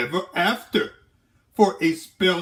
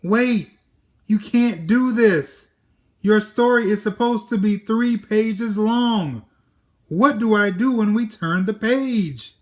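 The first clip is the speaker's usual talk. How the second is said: panicked; 6 semitones higher, 4 dB louder than usual. Acted. Narrator pleading, high pitch.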